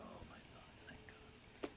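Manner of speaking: whispered relief, breathing it out; a soft thankful whisper under the breath; whispered thanks after getting the part; relieved whisper. Retold or imitated. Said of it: "So I said".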